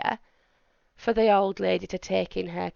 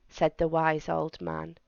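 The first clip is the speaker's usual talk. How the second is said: ordinary delivery, nothing marked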